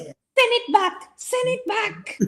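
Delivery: a high squeaky send-off, mocking him; mock husband. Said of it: "as my husband says".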